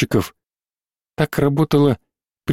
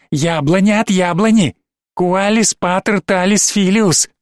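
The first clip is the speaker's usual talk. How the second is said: mocking demon child; 3 semitones higher than usual. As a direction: spit venom sweetly at mother. Демон в девочке глумится. (глумливый голос одержимой девочки)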